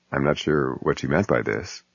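usual speaking voice

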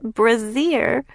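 put-on high, mischievous child's echo; giggly echo. A narrator's reading. Frances echoes a word her sister read, giggly. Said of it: "Frances giggles and echoes".

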